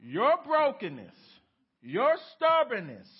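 preached at full volume. Real, not acted. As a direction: hammer the list home. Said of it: "That's a".

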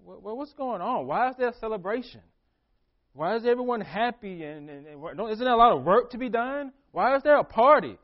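rising, agitated questions; indignant confused son, building to loud; confused older son. Retold or imitated. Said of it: "He's like".

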